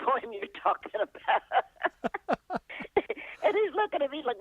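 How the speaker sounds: giggling at the absurd mix-up; laughing. Real, not acted. Telling a fan she doesn't know his poem, laughing. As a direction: laugh through the words, slowed right down.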